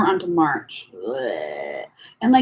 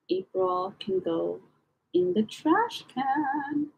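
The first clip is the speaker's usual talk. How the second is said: slow drawn-out dismissal; 6 semitones higher, 4 dB quieter, much slower than usual. Real, not acted. Tossing April's entries.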